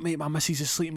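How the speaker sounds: high put-on protesting voice; anxious friend. Friend worried about his sleeping missus, high anxious protest.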